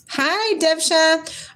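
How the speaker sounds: a high drawn-out hello; high, slow bright greeting. Real, not acted. Greeting a viewer in her live chat, high and slow. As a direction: sing out the viewer's name up high.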